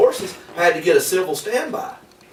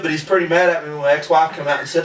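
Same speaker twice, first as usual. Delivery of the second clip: ordinary talk, nothing marked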